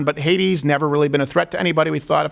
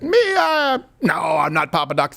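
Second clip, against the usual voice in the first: mock whimpering denial. The dictator whimpers a fake denial to the people. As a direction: play it coy with a fake sob. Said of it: "And then he was like".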